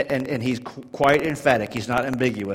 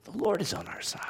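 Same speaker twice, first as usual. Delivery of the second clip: whisper; 8 semitones higher, 7 dB quieter, faster than usual. Real, not acted. Hushed close after loud preaching.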